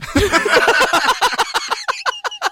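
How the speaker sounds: high-pitched burst of real laughter. Real, not acted. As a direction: crack up at the end of the story. Laughing at the punchline.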